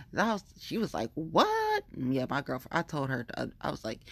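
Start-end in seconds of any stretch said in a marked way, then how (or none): 1.1-1.8 very high-pitched imitated disbelief; incredulous retort. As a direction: mimic a friend's astonished reaction. Girlfriend's reaction acted out.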